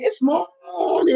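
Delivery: slow and high, stretching out morning; telling the viewer it is really morning, drawn out. Real, not acted. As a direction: drag the word out to correct them. Correcting the viewer's greeting.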